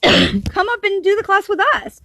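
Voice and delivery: high, loud, gushing friendly invitation; high eager call to join in; excited invite. Retold or imitated. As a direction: imitate them bubbling over with excitement. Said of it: "they're like".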